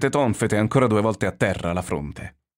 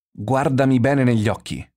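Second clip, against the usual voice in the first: higher, emphatic command; insistent repeat; 5 semitones higher, 4 dB louder than usual. A narrator's reading. Answering his friend's puzzled question, insistent command. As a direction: press the demand with lifted pitch. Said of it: "gli ripetei".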